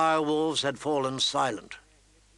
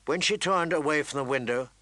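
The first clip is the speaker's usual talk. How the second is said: plain reading voice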